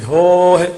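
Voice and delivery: ਹੋਏ as a held "hooo-he"; long o, short ending. Shown as a demonstration. Teacher drilling vowel lengths, long o in ਹੋਏ. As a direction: stretch the o of ਹੋਏ, lightly close it. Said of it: "ਸਿਹਾਰੀ ਛੋਟੀ".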